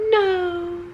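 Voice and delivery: high put-on "No."; a quick odd falsetto denial; weird voice. Telling the sister she isn't so-and-so, in a weird high voice. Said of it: "just purposely talking really weird".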